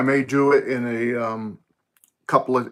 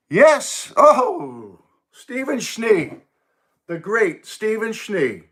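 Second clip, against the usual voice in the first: much higher, thrilled and slowed over the name; delighted surprise; 9 semitones higher, much slower than usual. Real, not acted. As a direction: gush at a hero's arrival. Famous guest joins stream.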